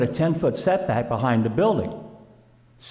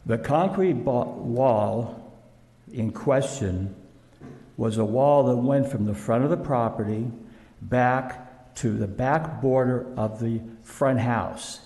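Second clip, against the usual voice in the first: ordinary delivery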